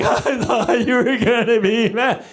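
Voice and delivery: slow, drawn-out pretend child wail; lightly hammed-up tearful voice; mock sobbing. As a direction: imitate a kid bawling. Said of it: "she is sobbing so hard".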